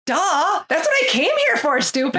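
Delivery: high, quick "duh" with mock scorn; sassy retort. Woman answering Eddie's question, quick and sassy. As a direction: toss it back like it's obvious.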